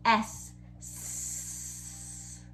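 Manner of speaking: "S, S" as a long hissing sss; drawn-out s like a snake. Shown as a demonstration. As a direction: hiss "S" like a slithering snake. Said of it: "Kinda sounds like a snake slithering".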